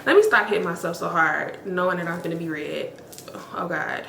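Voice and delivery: ordinary talk, nothing marked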